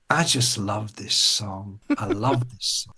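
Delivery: put-on slow, low, soft old lady; swooning old lady. Old bar lady hearing her favourite song, swooning. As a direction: draw it out softly, swooning over the song. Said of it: "I can't do the American accent".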